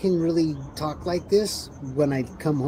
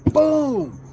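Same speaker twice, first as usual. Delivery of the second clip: much higher, louder exclamation; Mike's triumphant boom; 14 semitones higher, 8 dB louder than usual. Retold or imitated. Retelling Mike at the button, triumphant shout. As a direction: burst out like hitting the button. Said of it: "He's like".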